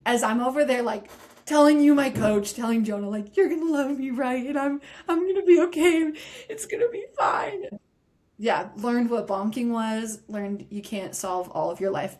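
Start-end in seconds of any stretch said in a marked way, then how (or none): 3.4-7.7 rising into a cracked, crying high pitch; teary pleading. Retold or imitated. Telling her coach mid-race, voice cracking into tears. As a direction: voice breaking as she begs reassurance.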